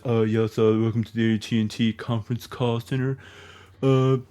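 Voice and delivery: bored low operator voice; call centre operator voice. Retold or imitated. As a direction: drone like a phone operator.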